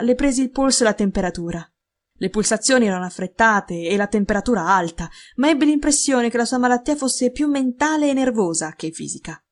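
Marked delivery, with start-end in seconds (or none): none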